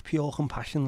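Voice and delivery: "pure compassion" in a high put-on Scouse accent. Streamer naming the song as a Scouse lad, high Scouse accent. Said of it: "the scousest sounding song".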